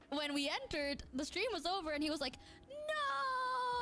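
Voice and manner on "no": high wailing cry of No; anguished no. Retold or imitated. Luca crying out that the stream ended. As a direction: wail it like a crushed kid.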